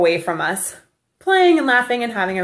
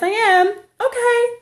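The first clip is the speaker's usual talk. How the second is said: high-pitched mock holler; replayed calls between mother and child; calling out; slower than usual. Retelling the exchange with her son across the field, calling out.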